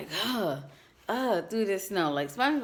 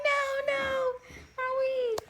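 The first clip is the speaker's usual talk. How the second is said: mock panicked squeal. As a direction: squeal like you're about to lose your hair. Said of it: "I'm like".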